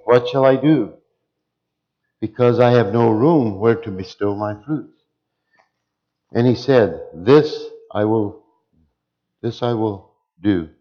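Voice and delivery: loud, measured Bible reading from the pulpit; preacher reading the rich man's parable aloud; scripture reading. Acted.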